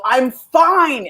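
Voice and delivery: high-pitched, unconvincing reassurance; mock upset insistence. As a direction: insist it while clearly upset. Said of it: "Your voice sounds like it's upset".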